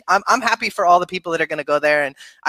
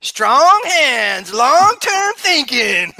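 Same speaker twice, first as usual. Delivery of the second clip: higher, louder, slow slogan; 7 semitones higher, 5 dB louder, much slower than usual. Acted. Guest signs off the show with his motto, slow and grand. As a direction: draw out the motto like a hero's slogan. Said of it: "You sound like someone I know".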